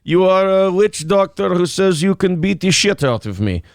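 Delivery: mock Russian accent, giving orders; Putin in Russian accent. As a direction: play a comic Russian strongman. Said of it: "you can do a Russian accent".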